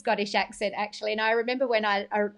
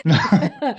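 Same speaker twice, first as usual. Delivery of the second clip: laughing protest; 9 dB louder than usual. Real, not acted. Teasing back, laughing.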